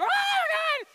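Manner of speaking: piercing high mock shriek; hammed-up panicked shriek, very loud; imitated scream. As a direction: scream like mom caught undressed. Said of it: "She screamed".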